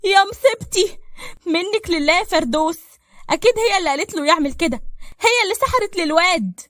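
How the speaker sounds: very high, loud lament; distraught wailing. Acted. Mother learns her son stole money, wailing and blaming Ferdous. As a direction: wail and curse in hysterical blame. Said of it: "نعمة لطمت على وشها".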